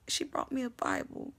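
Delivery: ordinary talk, nothing marked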